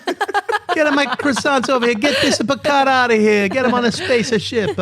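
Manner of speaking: high, hammed-up fake French sliding into Italian; loud, silly French-Italian accent; bad French accent. Host plays Picard's French family banishing him, bad French accent. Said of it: "So this is my French accent".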